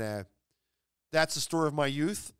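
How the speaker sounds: usual speaking voice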